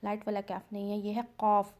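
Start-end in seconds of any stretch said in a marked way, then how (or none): 1.4-1.7 काफ said heavy, with tafkheem; heavy throat qaf. Shown as a demonstration. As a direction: push काफ from the back of the throat. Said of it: "हलक से इसकी आवाज़ काफ".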